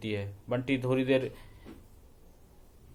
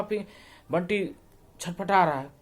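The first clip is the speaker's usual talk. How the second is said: plain reading voice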